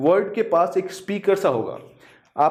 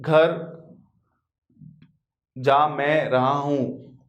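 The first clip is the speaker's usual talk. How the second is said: slow example; 2 semitones lower, much slower than usual. Shown as a demonstration. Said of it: "जैसे मैं बोल रहा हूँ कि".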